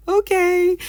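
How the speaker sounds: very high, loud, amused okay; re-enacted bemused okay. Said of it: "We were like".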